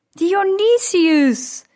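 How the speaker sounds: high, drawn-out shout of the name; geschreeuwde uitroep. A narrator's reading. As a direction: call out the name loudly, stretching it. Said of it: "schreeuwde ik". (langgerekt uitgeroepen, hoog en luid)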